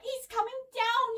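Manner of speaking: put-on high, excited girl's yell; thrilled high-pitched cry. A narrator's reading. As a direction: call it out, voice way up. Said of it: "yelled Debbie".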